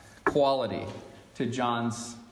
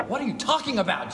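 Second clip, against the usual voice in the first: quick, higher-pitched mock bafflement; baffled; 6 semitones higher, faster than usual. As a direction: question it in playful disbelief. Acted baffled reply to Jesus.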